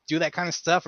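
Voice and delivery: ordinary talk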